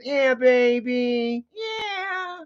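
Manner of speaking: slow, stretched cooing; drawn-out doggy praise; sweet talk the dog, slowly. Real, not acted. Cooing at his dog Penny.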